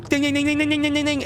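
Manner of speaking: rapid high monotone dinging; mock-annoying string of high dings; imitated flashlight spam. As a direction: mimic frantic flashlight flicking on one high note.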